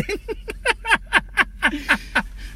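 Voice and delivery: long, high wheezy laugh; high-pitched laughing fit. Real, not acted. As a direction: crack up helplessly at your own punchline. Laughing at own punchline.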